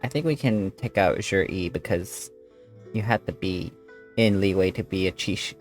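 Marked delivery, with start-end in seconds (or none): none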